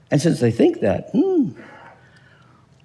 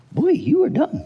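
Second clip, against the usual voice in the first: higher, lightly mocking boy; mocking thought; 9 semitones higher than usual. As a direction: voice the judging neighbour. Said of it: "they're thinking".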